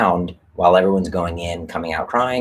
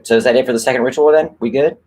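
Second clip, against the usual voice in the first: nonchalant; 7 semitones higher, 5 dB louder, faster than usual. Retold or imitated. Onyx shrugs after smashing mirror.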